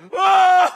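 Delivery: high, very loud whoa; mock terrified scream. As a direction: yell in exaggerated fright. Acts grabbing stranger's arm in terror.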